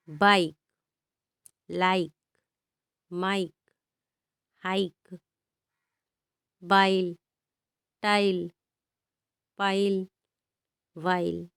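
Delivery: "बाइक" "लाइक" said with a full long ai glide, one by one; long i demo. Shown as a demonstration. Teacher modelling the long ai sound for students, carefully. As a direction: stretch the ai in "माइक" and "टाइल", word by word. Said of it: "तो वहाँ पे हम आई पढ़ेंगे।".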